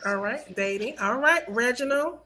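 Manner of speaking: normal talking voice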